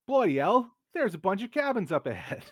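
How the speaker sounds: put-on loud, high failed Cockney accent; a shouted try at Cockney; attempted Cockney accent. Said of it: "I cannot do a Cockney accent".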